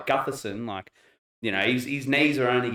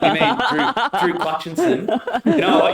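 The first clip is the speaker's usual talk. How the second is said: laughing; 8 semitones higher, 5 dB louder than usual. Real, not acted. Mocking a misheard name.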